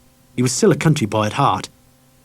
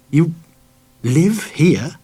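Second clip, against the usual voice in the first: dumbfounded; 5 semitones higher, much slower than usual. A narrator's reading. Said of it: "He sounded dumbfounded".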